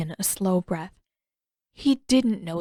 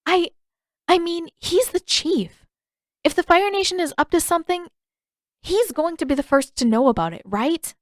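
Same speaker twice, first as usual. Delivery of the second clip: put-on higher, fast, nervous girl covering a slip; flustered Katara; 9 semitones higher, 3 dB louder than usual. A narrator's reading. Katara to Paku, hiding her secret, higher and fast. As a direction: rush the excuse.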